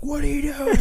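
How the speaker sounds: Billy yelping at the driver, high-pitched; imitating Billy. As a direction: shriek the question at the driver. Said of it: "he's like".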